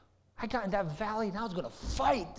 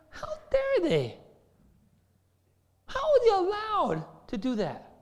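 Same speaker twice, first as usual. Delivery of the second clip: outraged disbelief; 13 semitones higher than usual. Retold or imitated. David outraged at the giant's taunts, high indignant cry. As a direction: sound appalled, pitch way up. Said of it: "And he's saying".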